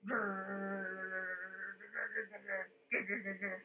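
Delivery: rolled, growling stomach rumble; rumbling tummy noise. Shown as a demonstration. Teller showing children how a tummy rumbles, growling. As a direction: imitate a hungry tummy rumbling. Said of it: "What sound does our tummy make".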